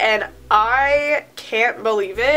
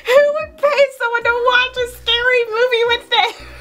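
very high incredulous squeal; incredulous, pitched far above normal; high-pitched disbelief; 12 semitones higher than usual. Real, not acted. Incredulous reaction to own story.

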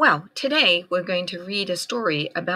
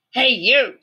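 grouchy ladybug voice; 7 semitones higher, 5 dB louder than usual. A narrator's reading. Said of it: "said the grouchy ladybug".

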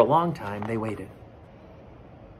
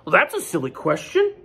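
higher, dismissive goose voice; honking goose; 6 semitones higher than usual. Acted. Goose answering Duck's silly question, honking. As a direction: honk it a bit high, as Goose. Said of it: "Goose honked".

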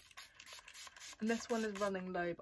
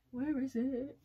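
higher, louder repeat of Where is it; louder searching call; 5 semitones higher, 4 dB louder, slower than usual. Real, not acted.

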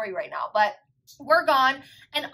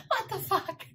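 high, soft, incredulous laugh-through; laughing disbelief; 8 semitones higher, 6 dB quieter, slower than usual. Retold or imitated. Sister reacting to brother hiding in bushes, laughing disbelief. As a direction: laugh helplessly through the words.

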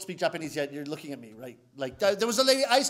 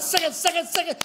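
higher, varied wrong takes of second; high wrong variations; 6 semitones higher than usual. Shown as a demonstration. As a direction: try every wrong way. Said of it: "Every variation except the correct one".

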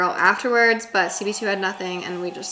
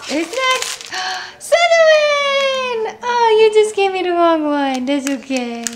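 gasp, then a much higher, louder, slow cry of the house; excited announcing; 12 semitones higher, 9 dB louder, slower than usual. Real, not acted. Sorting her dogs into houses, thrilled high announcing. Sorting-hat game with dog.

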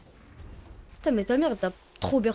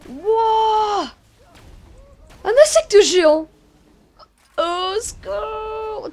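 panicking gamer; 7 semitones higher, 7 dB louder, much slower than usual. Real, not acted. Giant bug appears.

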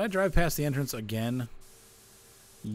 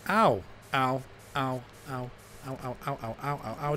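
repeated yelps of pain; ow ow ow, being hit. Real, not acted. Child hitting his head.

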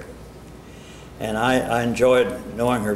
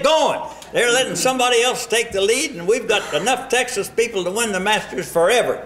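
higher voice, pushy and urging; rally him to fire up the Texas boys; imitating Babe Zaharias; 9 semitones higher than usual. Radio man quoting Babe urging him to rally Texas players, in a higher, pushy voice. Babe's demands retold on the phone.

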